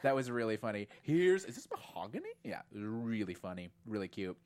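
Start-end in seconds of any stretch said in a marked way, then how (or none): 1.0-2.3 Shining quote. Retold or imitated. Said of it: "the Shining reference".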